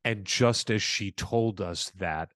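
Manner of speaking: ordinary delivery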